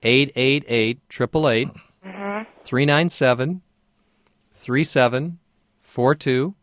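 reading out digits slowly and clearly; slow dictation. Real, not acted. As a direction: spell out each number slowly for the caller. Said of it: "Would you please give me the phone numbers".

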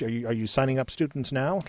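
normal talking voice

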